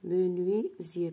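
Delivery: Menuisier with the S voiced as a Z, said slowly; Menuisier, the S sounding like Z, teacher's slow demo. Shown as a demonstration. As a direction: stretch out Menuisier, buzzing a Z in the middle. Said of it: "S entre deux voix se prononce Z".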